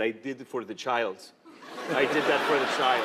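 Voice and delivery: imitated Egyptian accent, solemn; earnest line in an Egyptian accent; Egyptian driver. Driver explaining why he sped, Egyptian accent. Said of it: "I swear, he, he said this".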